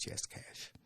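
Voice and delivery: hushed whisper of a secret offer; whispering yes, cash. Retold or imitated. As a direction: breathe it like a back-room deal. Secret cash bribe handed over.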